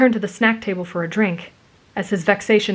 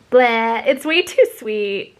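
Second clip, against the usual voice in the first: slow, drawn-out disgust; complaining; 3 semitones higher, much slower than usual. A narrator's reading. Girl complains about the punch, disgusted. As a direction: whine it, put off by the taste. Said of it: "Uraraka complained about the punch".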